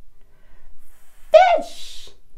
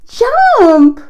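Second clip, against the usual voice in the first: bouncy, high, loud shouts of jump; excited; 8 semitones higher, 7 dB louder than usual. Real, not acted. Excited toddler play, jumping together.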